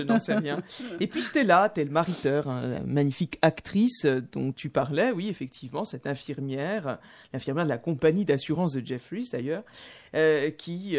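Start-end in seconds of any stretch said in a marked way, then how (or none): none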